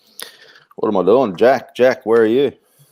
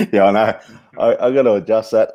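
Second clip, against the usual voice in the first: normal talking voice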